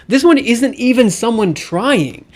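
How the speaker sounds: usual speaking voice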